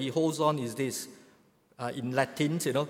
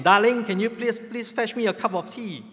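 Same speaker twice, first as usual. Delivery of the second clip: raised, high-pitched repeat call; 7 semitones higher, 5 dB louder than usual. Acted. Old man to wife from the corridor, calling out. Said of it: "and called out again".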